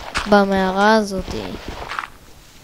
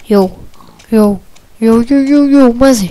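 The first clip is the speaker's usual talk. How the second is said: excited yelling; 2 semitones higher, 7 dB louder, much faster than usual. Real, not acted. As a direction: yell in thrilled amazement. Excited discovery in game.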